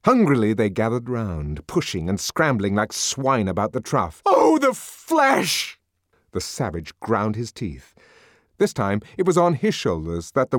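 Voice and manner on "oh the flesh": anguished cry. A narrator's reading. Savage in frenzy whipping.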